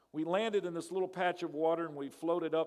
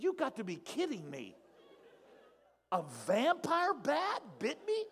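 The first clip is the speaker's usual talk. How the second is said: stunned disbelief; 10 semitones higher than usual. Retold or imitated. To the guide about the vampire bat, retold with stunned disbelief. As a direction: let the disbelief climb as you say it. Retelling his shock at the bite.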